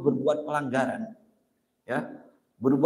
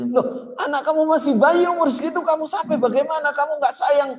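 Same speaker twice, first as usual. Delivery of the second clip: indignant scolding; 11 semitones higher, 3 dB louder than usual. Retold or imitated. Caliph Umar scolds the mother.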